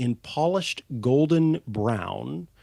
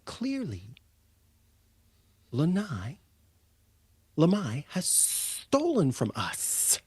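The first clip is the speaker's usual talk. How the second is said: put-on young kobold; 4 semitones higher, 4 dB quieter than usual. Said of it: "and says".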